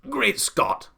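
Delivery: high, loud, staggered exclamation; Duke gasping in shock. A narrator's reading. Duke staggered by offered cherries, shocked gasp. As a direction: blurt it out, thunderstruck. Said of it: "he gasped".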